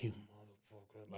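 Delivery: very soft, low, slow curse; muttered curse. Retold or imitated. Groom to teasing best man, slow muttered curse. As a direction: mutter it under your breath.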